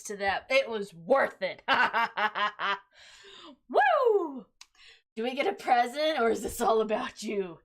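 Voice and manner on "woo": high celebratory woo; excited whoop. Real, not acted. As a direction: whoop with joy.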